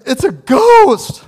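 a sudden high, loud scream of fear; terrified shout. Retold or imitated. Frightened disciples on the stormy lake shout in terror. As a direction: disciples yelling in panic at a ghost.

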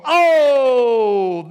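long, loud, high "oh"; exclaiming. Acted. Preacher reacting to Elizabeth's blessing, loud exclamation.

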